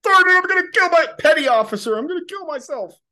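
high, loud whining recruit; whiny recruit voice. Acted. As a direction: cry it out like a panicking sailor. Recruit voice again.

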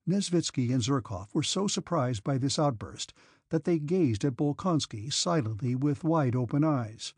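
normal talking voice

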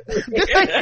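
ordinary talk

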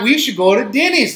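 high, loud hammed-up Canadian voice; mock Canadian accent. [name] suggests going to Denny's, hammed-up Canadian accent. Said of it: "[name] and Jerry call Canada".

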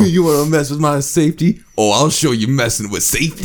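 hammed-up menace with a laugh in it; mock road rage. Teasing friend about his road rage, mock menacing.

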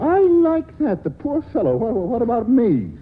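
high, slow mock outrage; indignant. Man protests that the gunman gets the pity, indignant. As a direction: play up being wronged.

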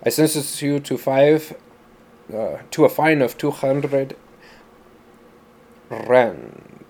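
put-on judge passing sentence in a South African accent; South African-accented judge, halting delivery; heavy South African accent. A narrator's reading. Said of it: "he said in a heavy accent".

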